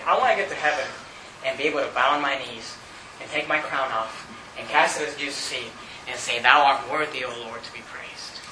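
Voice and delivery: hushed, low reverence; softly, as if bowed at Jesus' feet; humble worship. Acted.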